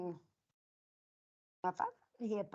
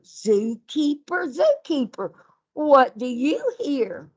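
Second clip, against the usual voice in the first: lively storytime read, louder on the animal names; reading to children; 9 dB louder than usual. Acted. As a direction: call out the names like a game for the class. Teacher reads animal picture book.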